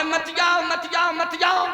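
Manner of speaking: high-pitched mock begging; pleading wife. Preacher mimics wife ignoring his advice, high mock pleading. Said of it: "और कहे".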